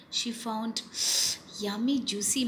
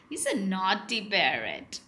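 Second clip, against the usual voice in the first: ordinary delivery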